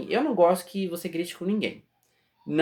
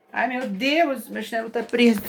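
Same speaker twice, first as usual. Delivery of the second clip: higher-pitched real fluster; startled exclamation; 4 semitones higher than usual. Real, not acted. Speaker interrupted by a stuck slipper, startled exclamation. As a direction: exclaim at a sudden small mishap.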